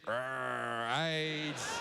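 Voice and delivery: low, growled pirate-like snarl; mock aggressive growl. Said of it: "the aggressive".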